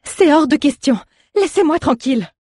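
high, loud panicked cry; terrified cry. A narrator's reading. Terrified girl crying out at the old druid. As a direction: scream it out in terror. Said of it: "terrifiée, elle s'écria".

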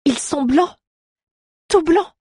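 high, rising astonished exclamation. Acted. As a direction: stress the whiteness in disbelief.

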